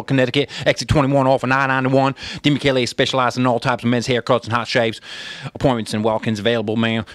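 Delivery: fast-patter Southern auctioneer ad read; Southern auctioneer twang. Acted. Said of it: "in twang, like Southern twang, as an auctioneer voice".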